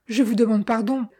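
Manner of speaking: plain reading voice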